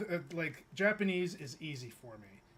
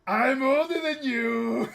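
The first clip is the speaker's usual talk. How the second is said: playful mock boast; 8 semitones higher, 12 dB louder, slower than usual. Real, not acted. Teasing the young viewer.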